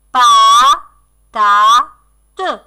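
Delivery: "Pa, ta, te" with a long gap after each; scanned syllables. Shown as a demonstration. Teacher breaking the last word down for children, syllable by syllable. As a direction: beat out "Pa, ta, te" one syllable at a time.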